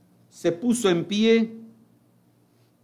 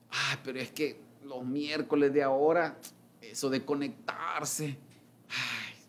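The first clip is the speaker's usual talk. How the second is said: mock excuse; 5 semitones lower, 9 dB quieter than usual. Mocking a churchgoer's excuse.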